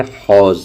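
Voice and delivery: "हौज़" with a throaty h; "हौज़" said the proper Arabic way; correct Urdu pronunciation. Shown as a demonstration. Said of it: "सही इसका उच्चारण करना चाहते हैं तो".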